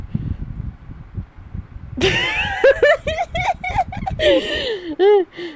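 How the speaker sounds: high, shrieking burst of laughter. Real, not acted. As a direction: explode into laughter. (malakas na tawa)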